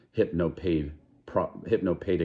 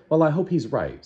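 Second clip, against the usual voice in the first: Lenina's voice; 8 semitones higher, 4 dB louder than usual. Acted.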